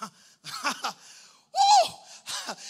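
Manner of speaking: spaced-out laugh, then a whoop; hearty preacher's laugh; mock laugh and whoop. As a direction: laugh it out, then let out a "woo". Acting out God's laugh, whoop.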